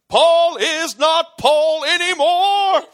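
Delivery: Mister Tibbs delivery. Retold or imitated. Podcaster imitates Johnny's big line, hammed-up and slow. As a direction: deliver it like a grand film moment. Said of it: "that's how he says it".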